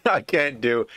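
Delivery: ordinary talk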